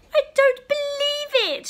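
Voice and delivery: high, loud shocked shout; father's shocked outburst. Acted. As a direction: shout in disbelief. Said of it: "shouted Father".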